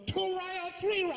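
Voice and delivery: very high Chinese waiter caricature; squeaky cartoon Chinese accent; mock Chinese accent. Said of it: "same accent as the waiter".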